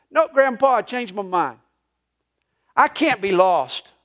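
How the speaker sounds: a hint of a beaming little boy. Grandson telling grandpa he changed his mind, lightly boyish.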